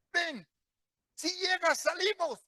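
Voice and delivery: grito alto desde el agua; llama al hijo con urgencia, más alto; llamado urgente. Retold or imitated. (padre grita al hijo en el mar con urgencia)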